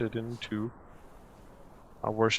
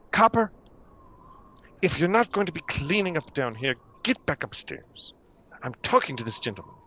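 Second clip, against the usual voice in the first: higher, scolding and dismissive; irritated priestess; 6 semitones higher than usual. Acted. Mishan scolding the gnome in front of the guest, higher. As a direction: shoo the lodger back upstairs. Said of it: "Mishan says".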